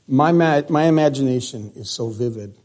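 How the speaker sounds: ordinary talk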